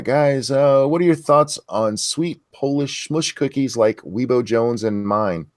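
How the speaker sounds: usual speaking voice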